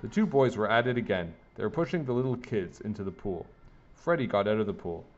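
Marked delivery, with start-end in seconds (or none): none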